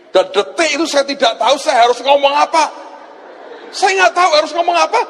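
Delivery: high-pitched, hammed-up helplessness; flustered shock. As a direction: replay being at a total loss, voice way up. Replays his own shock and helplessness. (pura-pura bingung kaget, suara tinggi)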